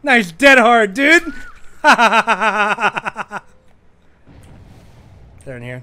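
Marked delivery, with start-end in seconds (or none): 0.0-1.2 high, very loud gamer shout; excited yell. Real, not acted. Player reacts to a dead hard, yelling in excitement. 1.3-5.4 long, high rapid-fire cackle; loud burst of laughter. Real, not acted. Player cracks up after the dead hard, laughing loudly.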